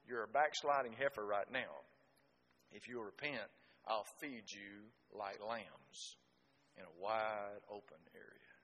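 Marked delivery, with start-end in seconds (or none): 2.7-8.5 tender promise. Retold or imitated.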